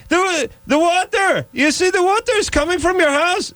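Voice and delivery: insistent caricature of an Israeli neighbor; horrible Israeli accent. Retold or imitated. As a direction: drag it out in a falsetto Israeli accent. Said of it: "It's a horrible accent".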